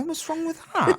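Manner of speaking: high mock-offended; mock indignant. Real, not acted. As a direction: play it defensively outraged. Defending his beer, mock indignant.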